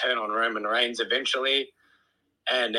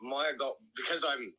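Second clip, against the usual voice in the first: mouth full; 3 semitones higher, 6 dB quieter than usual. Real, not acted. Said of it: "Are you eating chips?".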